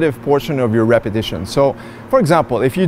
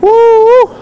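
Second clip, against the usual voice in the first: whooping cheer; 7 dB louder than usual. Real, not acted. Shout after a heavy lift.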